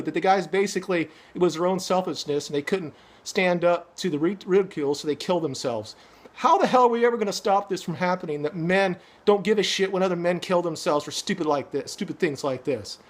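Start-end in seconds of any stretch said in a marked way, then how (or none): none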